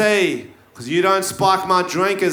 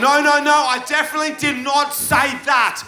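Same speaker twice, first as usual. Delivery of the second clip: raised voice; 7 semitones higher than usual. Acted. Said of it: "you've raised your voice".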